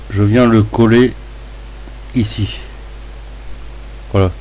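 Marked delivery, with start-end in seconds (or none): none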